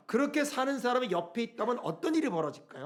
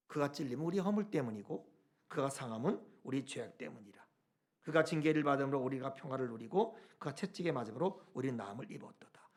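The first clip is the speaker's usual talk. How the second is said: hushed recitation; 7 semitones lower, 6 dB quieter than usual. Acted. Scripture recited softly, fading.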